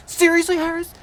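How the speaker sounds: high, loud scolding teacher imitation; snapping yell. Third-grade teacher correcting a pupil's word, mock snapping yell. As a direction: snap at the kid, starting high and loud. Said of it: "she snaps to me, yells at me".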